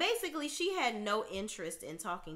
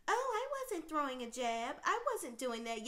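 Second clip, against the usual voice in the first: mock innocence; 6 semitones higher than usual. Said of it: "pretend like".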